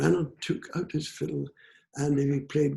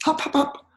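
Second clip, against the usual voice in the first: loud, popping hops, pitched up; bouncy hopping calls; 12 semitones higher, 5 dB louder than usual. A narrator's reading. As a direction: punch out each hop like a little leap. Imitating little men leaping up.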